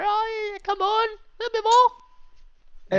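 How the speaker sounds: high, slow, straining coax; straining high plea. Acted. Urging the lock on.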